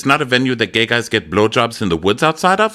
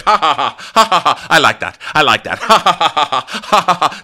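high, loud fake cackle; mock forced laugh; 8 semitones higher, 4 dB louder than usual. Gertie laughing too hard at her own joke. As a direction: overdo the laugh as a hammed-up bit. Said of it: "she's laughing too hard".

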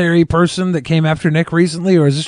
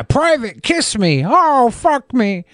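mock drill sergeant; 7 semitones higher, slower than usual.

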